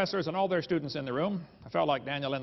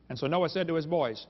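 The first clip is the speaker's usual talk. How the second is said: usual speaking voice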